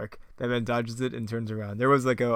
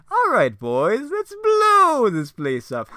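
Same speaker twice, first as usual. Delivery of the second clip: high, loud, slow Joker drawl; a gleeful villain boss, hammed up; terrible Joker voice; 12 semitones higher, 10 dB louder, much slower than usual. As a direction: Joker rallying his goons, hammed-up high voice. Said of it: "my terrible Joker voice".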